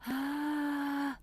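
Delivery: whispery creak held out; airy fry on one note; breathy fry attempt. Shown as a demonstration. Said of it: "that's like a whisper".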